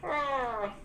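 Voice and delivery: high, slow froggy Kermit voice; sing-song it like the frog. Pretend. Joking she sounds off, does a high slow Kermit. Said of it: "I sound like Kermit the Frog".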